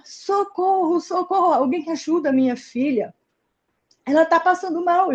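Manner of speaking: high, urgent calls for help, mildly voiced; retold mother's cries for help. Mother calling for help over her fainting daughter, high and urgent. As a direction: echo a frantic mother begging for help. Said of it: "ouvindo os gritos dela".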